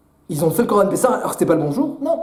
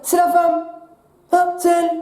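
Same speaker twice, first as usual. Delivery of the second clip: Adam blaming his wife; 6 semitones higher than usual. Acted. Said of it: "Qu'est-ce qu'il dit Adam Arishon?".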